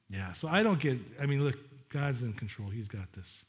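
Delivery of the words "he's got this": usual speaking voice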